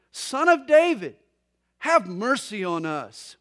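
loud, high call that drops on the plea; crying out. Acted. Pastor voicing two blind men calling to Jesus, crying out. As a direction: call out, then come down onto the plea. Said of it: "crying out and saying".

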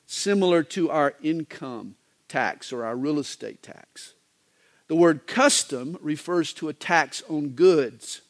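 ordinary talk, nothing marked

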